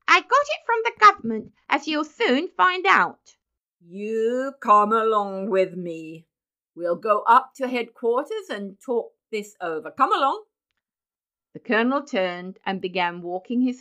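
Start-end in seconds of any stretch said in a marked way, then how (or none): 0.1-3.4 high, loud, heated comeback; indignant retort. Acted. 3.8-10.4 commanding colonel. Acted. Colonel orders Benjamin to follow him.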